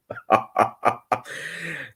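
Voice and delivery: soft, breathy run of chuckles; real laugh trailing on. Real, not acted. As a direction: chuckle helplessly at yourself. Laughs at own blurry face.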